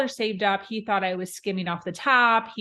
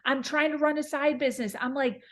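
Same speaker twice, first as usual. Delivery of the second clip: frantic retold rant; 7 semitones higher than usual. Retold despair in the car.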